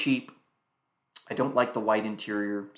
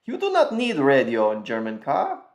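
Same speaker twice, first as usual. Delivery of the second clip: put-on German accent; 3 semitones higher, 5 dB louder than usual. Mock German joke continues.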